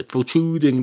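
ordinary talk, nothing marked

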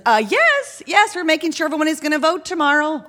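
high, quick, eager call back. Acted. Canvasser answers woman in upstairs window, calling up. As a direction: call up to someone above, pitched high.

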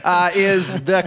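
ordinary talk, nothing marked

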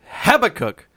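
Habakkuk punched high and loud on HAB; said with vigor. Shown as a demonstration. Friend showing off an odd Bible name with emphatic vigor. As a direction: hit HABAKKUK hard and high, with total confidence. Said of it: "you should say it with more vigor".

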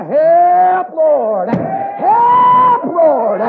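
high, loud, long-held holler; crying out. Acted. Preacher urging a cry for defense from Satan, high shout. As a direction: wail high, then fall on the second word. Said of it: "you better cry".

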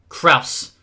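short, loud shout of a name; loud, sharp call. A narrator's reading. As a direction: shout the name to stop him. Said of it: "Luke shouted".

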